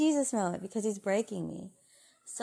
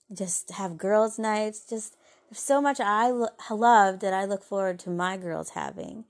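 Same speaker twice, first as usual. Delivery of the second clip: normal talking voice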